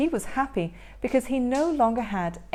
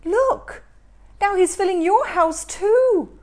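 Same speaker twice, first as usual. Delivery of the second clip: much higher, louder alarmed shout; 9 semitones higher, 8 dB louder than usual. Acted. Baktus shouting to Karius his house is being filled, alarmed shout. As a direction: yell the bad news to your brother. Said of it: "shouted Baktus".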